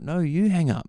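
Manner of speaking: high, teasing "you hang up"; playful mock coyness. Caller plays hang-up game with lady, high and coy. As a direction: swing the pitch up high, playfully insisting.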